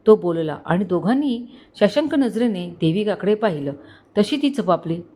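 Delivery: plain reading voice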